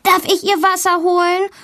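high-pitched schoolgirl blurting a question; eager calling out. A narrator's reading. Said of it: "ruft Valentina".

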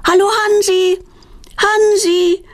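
high, sustained sing-out call; high-pitched calling with held vowels. A narrator's reading. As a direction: call across the rooftops for a lost pet. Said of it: "rufen die Mädchen laut".

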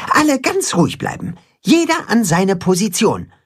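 high-pitched urgent order; commanding. A narrator's reading. Katzenhuhn orders animals to their places, commanding. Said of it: "befiehlt das Katzenhuhn".

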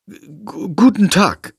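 stammering, taken aback hello; flustered greeting. Acted. Girl greeting a talking bat, flustered stammer. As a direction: stumble over the greeting, baffled. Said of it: "begrüßt Lilly die Fledermaus verdattert".

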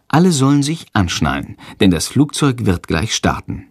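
ordinary delivery, nothing marked

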